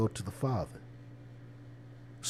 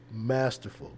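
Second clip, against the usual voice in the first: normal talking voice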